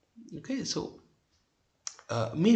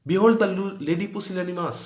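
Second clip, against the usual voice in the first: loud, raised theatrical announcement; mock proclamation; 5 semitones higher, 7 dB louder, slower than usual. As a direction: present them grandly, as a taunt. Husband mockingly presents his wife. (ব্যঙ্গভরা জোরালো ঘোষণার সুরে)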